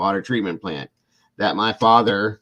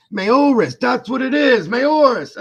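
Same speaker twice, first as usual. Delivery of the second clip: put-on high, loud excited old man's voice; old coworker recognizing the name, high and loud; 7 dB louder, slower than usual. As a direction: yelp the name out like an old man who just remembered. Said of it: "that's how he, this guy talks".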